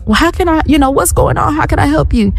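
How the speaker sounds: high-pitched imitation of a helpful stranger. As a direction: put on a high, politely enquiring lady's voice.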